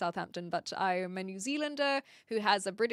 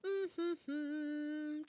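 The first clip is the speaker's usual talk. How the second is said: ordinary talk, nothing marked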